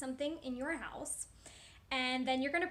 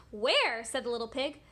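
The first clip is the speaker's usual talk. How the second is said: lively, louder read-aloud with big swoops; story read to children; 3 semitones higher, 8 dB louder than usual. A narrator's reading. Teacher reading the Three Little Pigs to children, animated storytelling. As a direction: read it animatedly, stretching key words.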